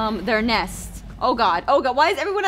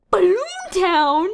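high, loud, stretched-out question; hammed-up astonished query; exaggerated disbelief; 6 semitones higher, 5 dB louder, slower than usual. Real, not acted. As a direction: draw the name out incredulously. Repeats name in exaggerated disbelief.

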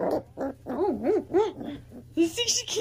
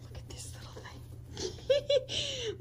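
slow, higher whispered adoring coo; 10 semitones higher, 4 dB quieter, much slower than usual. Real, not acted. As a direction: breathe it softly over the kitten. Cooing at the kitten.

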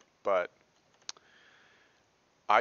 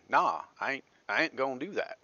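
higher, louder Texas twang; Texas country accent; 6 semitones higher, 7 dB louder than usual. Shown as a demonstration. Texan teacher demos her childhood accent for the class, higher and louder. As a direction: let your childhood Texas accent come out. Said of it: "my own childhood accent there coming out".

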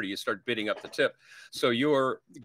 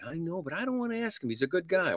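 wife pleading she won't ask; anxious spouse; 8 semitones higher than usual. Retold or imitated. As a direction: fret like you dread asking. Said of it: "husband and wife sneak away".

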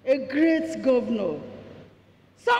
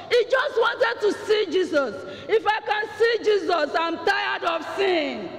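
preaching it out, higher and rising; fervent pulpit preaching; full-force preaching; 6 semitones higher than usual. Acted. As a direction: drive the sermon home with fire.

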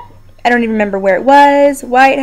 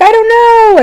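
much higher and louder, bewildered; replaying a dazed answer to the paramedic, loud and high; retold shocked reply; 12 semitones higher, 7 dB louder, slower than usual. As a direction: yelp it out, high and lost. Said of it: "I'm like".

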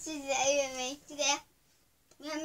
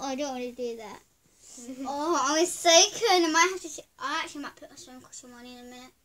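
ordinary talk

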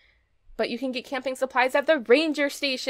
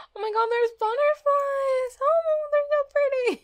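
much higher, gushing squeal of delight. Real, not acted. Player thrilled at butterflies in the game, squealing high. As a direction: gasp and gush over the butterflies. Repeats it after a gasp.

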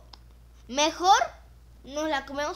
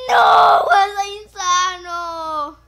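mock grief wail; 6 semitones higher, 14 dB louder, much slower than usual. Friend died.

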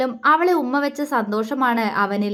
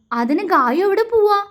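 higher, anxious question; frightened; 8 semitones higher, 3 dB louder than usual. Acted. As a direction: ask in sudden fear she will leave. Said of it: "അവൻ പേടിയോടെ അവളോട് ചോദിച്ചു". (പേടിയോടെ ഉയർന്ന സ്വരത്തിൽ ചോദ്യം)

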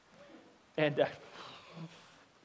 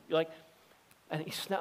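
ordinary delivery

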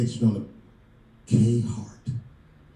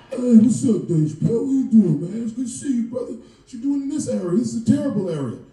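higher, louder hyped-up greeting; eager bodyguard; 7 semitones higher, 6 dB louder than usual. Retold or imitated. Kevin's bodyguard greeting Dave at the door, overexcited.

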